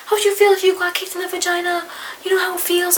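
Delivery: hammed-up outraged mother, repeating herself; imitating angry mother. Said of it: "starts screaming her head off at me".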